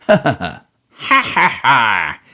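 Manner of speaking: put-on old miser's hard ha ha ha; hard mocking laugh. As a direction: laugh at him with cold spite. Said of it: "echoes ... in such a very hard manner".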